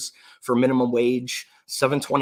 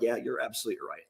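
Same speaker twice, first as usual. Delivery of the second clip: deflated agreement; 8 dB quieter, faster than usual. Retold or imitated. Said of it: "I'm like".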